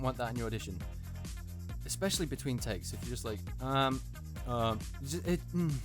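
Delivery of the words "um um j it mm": thinking noise. Shown as a demonstration. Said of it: "the thinking noise".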